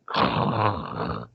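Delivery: drawn-out mouth-made laser noise; imitated mining laser. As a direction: mimic a child's pretend mining beam. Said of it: "pointed her hand at it and went".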